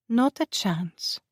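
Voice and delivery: slow, high purring tease. A narrator's reading. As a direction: purr it out slowly. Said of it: "Jackie purred".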